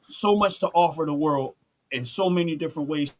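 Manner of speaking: ordinary delivery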